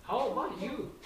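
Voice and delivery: ordinary delivery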